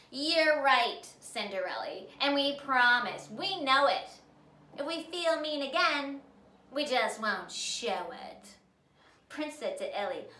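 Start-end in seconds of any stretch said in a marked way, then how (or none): 0.1-8.6 promising sisters. A narrator's reading.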